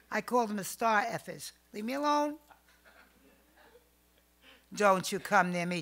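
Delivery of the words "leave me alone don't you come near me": brushing him off. Retold or imitated. She rebuffs the rich man.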